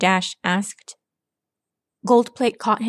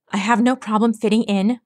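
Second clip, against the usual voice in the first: usual speaking voice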